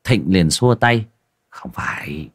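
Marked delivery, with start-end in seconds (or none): 1.5-2.3 whispered "Không phải", hushing the table; a cautious whisper to the drinkers; hushed whisper. Acted. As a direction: cut them off in a whisper. Thịnh hushes them.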